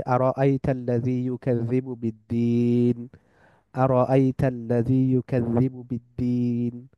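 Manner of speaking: slow, measured Quranic chant; teacher repeating a verse for learners; Quran recitation. Acted. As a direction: recite it patiently, over and over.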